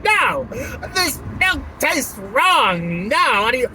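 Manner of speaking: loud, high, drawn-out mock whine; imitated complaining customer. Imitating drive-thru customers at Starbucks, loud high mock complaint. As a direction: wail the complaint, hammed-up. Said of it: "people who are just like".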